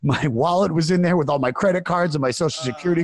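high and loud, piling it on in distress; rising panic. Retold or imitated. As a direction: list it all in a frantic rush. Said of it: "And she goes".

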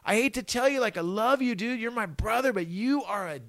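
a hint of a blunt but fond bandmate; retold bandmate telling him he's a dick; imitating bandmate. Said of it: "our guitar player goes".

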